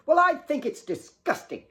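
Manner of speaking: high, sneering sister; snooty jab across the table; sneering disdain. Acted. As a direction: look down your nose at her. Sisters bickering at dinner.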